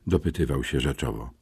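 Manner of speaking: ordinary delivery, nothing marked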